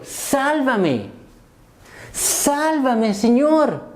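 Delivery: high, drawn-out cry for help. Retold or imitated. Preacher as sinking Peter calling on the Lord, crying out. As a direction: plead upward, building in force on the repeat. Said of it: "¿Y qué hace Pedro?".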